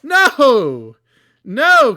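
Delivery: loud, high protest; shouting no. Real, not acted. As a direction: yell no in horror. Reacting to a ruined design.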